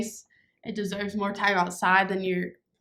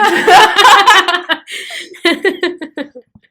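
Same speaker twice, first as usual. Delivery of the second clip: loud laughter; 7 semitones higher, 13 dB louder than usual. Real, not acted. Laughing hard.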